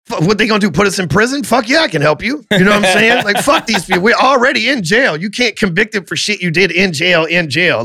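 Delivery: high, reckless fuck-it enthusiasm; gleeful agreement. Retold or imitated. Agreeing to launder the mob's money, pitched high and gleeful. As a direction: jump in high and cocky.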